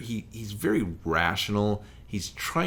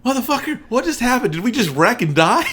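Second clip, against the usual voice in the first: much higher, loud panicked yell; startled awake shout; 13 semitones higher, 8 dB louder than usual. Retold or imitated. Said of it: "And she's like".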